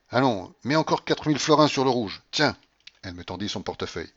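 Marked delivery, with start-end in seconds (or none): 0.1-2.6 higher, louder brisk order; bossy command. A narrator's reading. Grandmother handing over money to bet, bossy.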